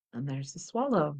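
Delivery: ordinary talk, nothing marked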